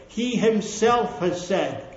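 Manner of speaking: he HIMSELF drawn out slow and weighty; stress on himself. Shown as a demonstration. Preacher telling the congregation he himself has said it, HIMSELF slowed and stressed. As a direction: lean hard on "himself", slow. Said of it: "very emphatic word".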